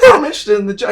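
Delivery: high, loud thrilled answer; eager yes. Retold or imitated. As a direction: jump at the offer. Eagerly accepting the job offer.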